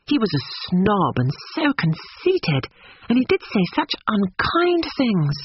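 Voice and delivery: put-on high, earnest young woman; indignant Lucy.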